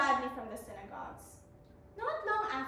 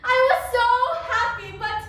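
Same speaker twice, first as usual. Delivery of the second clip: much louder and higher; joyful; 6 semitones higher, 12 dB louder than usual. Acted. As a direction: ring out with happiness. Joy after healing.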